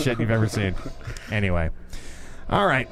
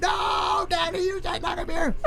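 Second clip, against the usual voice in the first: put-on much higher, airy terrified child's scream; mock child scream; 3 dB quieter than usual. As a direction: scream it like a panicked little girl. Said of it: "Like, screamed".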